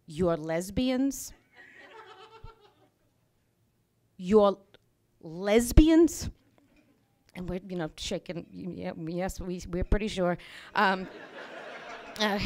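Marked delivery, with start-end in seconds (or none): 0.1-6.3 slow, drawn-out disbelief, with a long pause before the repeat of lesbians; incredulous slow question. Retold or imitated. Bikers repeating the question to the couple, slow and incredulous. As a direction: spell out their shock word by word. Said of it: "they say it just like this".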